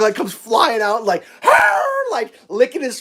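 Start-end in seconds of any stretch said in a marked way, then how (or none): 1.4-1.8 high, loud happy dog cry; mock doggy squeal; imitated excited dog. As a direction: yowl like a dog greeting its owner. Dog flying out to its owner.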